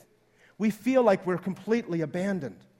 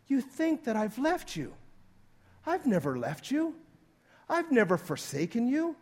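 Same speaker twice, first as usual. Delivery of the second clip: higher-pitched, wounded disbelief; incredulous scolding, pitched up; acted reproach; 6 semitones higher than usual. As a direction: reproach them as one wrongly doubted. God reproaches the doubting believer.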